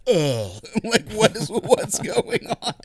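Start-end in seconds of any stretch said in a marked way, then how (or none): 0.1-0.5 pleasured groan. Retold or imitated. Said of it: "and I'm like".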